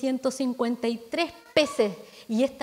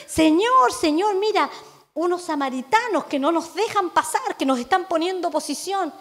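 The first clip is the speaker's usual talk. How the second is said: higher and louder, urgent tattling; excited complaint; 4 semitones higher, 5 dB louder than usual. Acted. Disciples reporting the Samaritans to Jesus, loud and urgent. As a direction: call out to the master, worked up.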